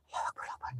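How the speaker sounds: voiceless frightened prayer; hushed prayer in fear; whispered prayer. Real, not acted. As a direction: whisper the prayer to calm yourself. Praying in fear at night.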